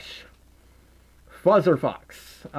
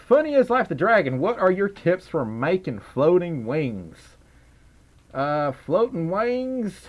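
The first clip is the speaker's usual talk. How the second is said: southern accent. Shown as a demonstration. Streamer reads a viewer's question in a southern accent. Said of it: "I can't talk southern when".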